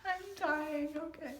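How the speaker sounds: real helpless laughter; laughing. Real, not acted. Reader unable to stop laughing at the scene. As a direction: crack up on it. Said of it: "can't read this with a straight face".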